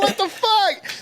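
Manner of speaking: very high retold amazement; happy disbelief. Acts out amazed reunion.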